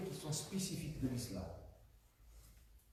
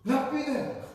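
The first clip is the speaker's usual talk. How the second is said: higher, louder restatement; emphatic repeat; 8 semitones higher, 6 dB louder than usual. Real, not acted. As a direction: announce the answer to the room. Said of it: "repeats answer loudly". (reprise appuyée)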